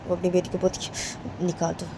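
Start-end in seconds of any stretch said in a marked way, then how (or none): 1.5-1.9 gentle coaxing. Acted. Father softly coaxes daughter.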